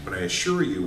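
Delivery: ordinary talk, nothing marked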